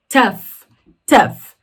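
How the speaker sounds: "tough" said as TUF, ending in F. Shown as a demonstration. Teacher giving another example word, "tough" pronounced with final F. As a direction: model "tough" as one punched syllable with a final F. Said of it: "outras palavras no inglês em que isso acontece".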